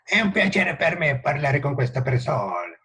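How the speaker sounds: mock Italian, sing-song and heated; Italian accent. Profe imitando a los italianos, acento italiano exagerado. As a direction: sound like Italians arguing. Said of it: "Los italianos parece que se están peleando".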